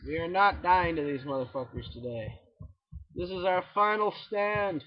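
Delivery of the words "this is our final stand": slow, grand, higher battle speech; mock heroic speech. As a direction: declaim it like a war hero. Mock epic final stand.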